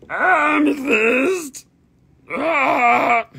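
high, loud strangled wail; Bobcat Goldthwait. Acted. Seller showing the amethyst as a comedian, hammed-up wailing shout. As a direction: drag the word out in a crying yell. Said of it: "that's Bobcat Goldthwait".

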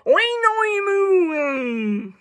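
long, wobbly oink melting into a moo, starting high; botched oink-moo. Acted. Pig trying to oink, long muddled oink-moo. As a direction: start high and slide down through a garbled oink. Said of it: "he's trying".

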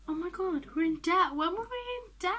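high, upset disbelief; shocked. Real, not acted. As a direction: cry out at bad news. Discovers debt in the game.

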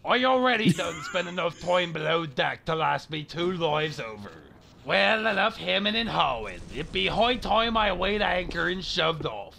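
lightly voiced pirate, farmer-ish West Country. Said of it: "they all talk like that".

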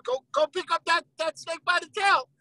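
usual speaking voice

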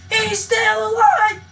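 high, loud, drawn-out cry of amazement; excited shout. Real, not acted. Gamer spots his friend alive, excited shout. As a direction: shout it in thrilled disbelief.

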